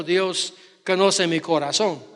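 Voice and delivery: ordinary talk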